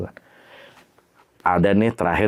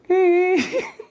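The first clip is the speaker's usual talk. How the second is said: short, high ghostly whine; high thin mock sob; imitated crying. Storyteller imitating the crying on the radio, high whine. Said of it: "Lama-lama dari tadinya nangis".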